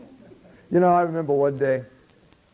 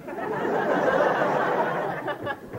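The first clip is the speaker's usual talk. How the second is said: a high, airy burst of laughter; breathy laugh; 13 semitones higher, 4 dB quieter than usual. Acted. Matty laughing at Jesus' reply, breathy laugh. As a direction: laugh along at the joke.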